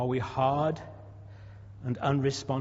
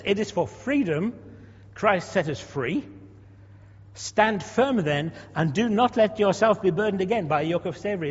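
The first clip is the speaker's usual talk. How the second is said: solemn scripture reading; 3 semitones higher, 3 dB louder than usual. Acted. Reading Galatians aloud to congregation.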